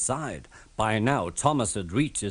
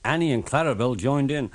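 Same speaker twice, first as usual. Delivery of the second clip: usual speaking voice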